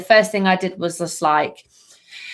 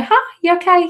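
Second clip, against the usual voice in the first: high, sugary mock greeting; fake-sweet hello; 10 semitones higher, 3 dB louder than usual. Smiling at someone you dislike, mock sweet. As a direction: greet them all smiles to annoy. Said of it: "Or you just go".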